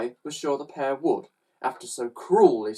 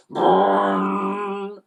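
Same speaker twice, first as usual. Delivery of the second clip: long vroom, raised and fading; engine roar; 7 semitones higher, 4 dB louder than usual. A narrator's reading. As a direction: imitate an engine revving away. Narrator imitates the roaring engine.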